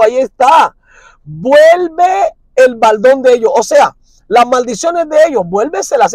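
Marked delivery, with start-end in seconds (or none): 1.2-3.5 slow, drawn-out solemn prayer. Retold or imitated. Preacher voicing Nehemiah praying slowly and solemnly. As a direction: plead it slowly to the Lord. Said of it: "Nehemías cuatro cuatro".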